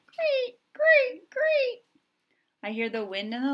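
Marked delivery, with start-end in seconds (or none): 0.1-1.8 very high, loud chirp; cricket chirp. A narrator's reading. Crickets in the grass, high chirping. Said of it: "The crickets go".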